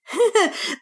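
short high-pitched chuckle. A narrator's reading. Mick amused by Ralph's talk of the warriors, chuckling.

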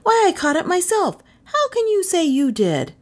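put-on high, indignant boy's protest; consternation. A narrator's reading. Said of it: "Kay cried in consternation".

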